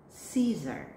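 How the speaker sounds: usual speaking voice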